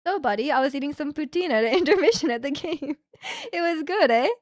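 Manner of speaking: light mock Canadian lilt with a tagged eh; hammed-up Canadian. Shown as a demonstration. Streamer to chat, teasing Canadian talk with a mock eh. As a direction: play up the cheerful Canadian buddy talk. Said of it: "That's pretty Canadian".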